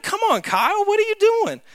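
high-pitched mock protest; scolding a friend. Young narrator calling out his friend in the snow, high and indignant. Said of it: "I'm like".

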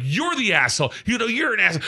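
mock angry comeback; retold yelling. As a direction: fire the insult back, pitch climbing. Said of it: "I'm yelling so loud".

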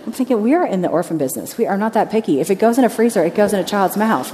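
ordinary talk, nothing marked

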